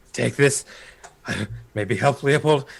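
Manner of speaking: halting, lightly acted wounded voice; hoarse mumbling. Wounded Weimar pushes sword onto Malcolm, hoarse halting plea. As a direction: plead haltingly, rising toward the end. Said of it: "with a very hoarse voice".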